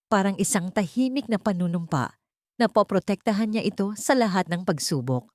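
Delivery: usual speaking voice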